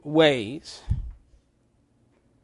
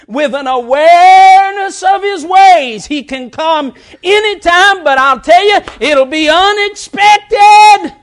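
loud, high preacher's shout, drawn out at the end; full-force preaching; 12 semitones higher, 13 dB louder than usual. Real, not acted. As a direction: cry out the warning to the congregation.

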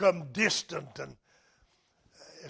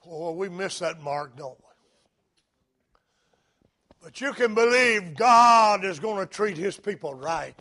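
ordinary talk, nothing marked

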